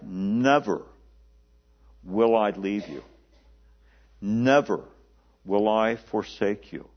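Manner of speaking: "Never" hit hard and falling, a pause after it; solemn, slow "Never will I forsake you"; emphatic. Shown as a demonstration. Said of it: "it's emphatic".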